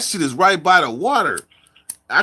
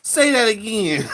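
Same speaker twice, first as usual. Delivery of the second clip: high, drawn-out mocking imitation; mimicking locals' amazement; 7 semitones higher, slower than usual. Locals marveling at his way of talking, high mimicry. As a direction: stretch it out high, imitating fascinated listeners. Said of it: "they be like".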